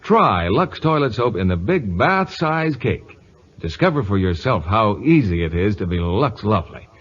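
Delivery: usual speaking voice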